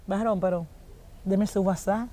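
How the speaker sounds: a flirty whisper-close ask for his number; sly hushed request. Retold or imitated. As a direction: slip him the request quietly, like a secret. Woman flirting with the preacher.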